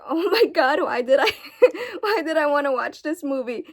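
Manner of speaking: loud, laughing at yourself; laughing disbelief. Retold or imitated. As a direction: laugh through the regret. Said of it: "and I was like".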